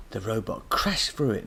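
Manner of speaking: ordinary delivery, nothing marked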